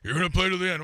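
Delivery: mock stern dad. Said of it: "He said".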